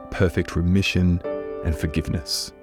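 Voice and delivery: ordinary talk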